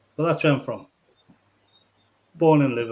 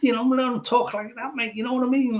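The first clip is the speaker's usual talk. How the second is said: put-on Liverpool Scouse accent, pitched higher; 10 semitones higher, faster than usual. Shown as a demonstration. Liverpool man showing his lost Scouse accent, Scouse accent. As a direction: slip back into a Scouse accent for a moment. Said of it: "I lost a lot of my accent".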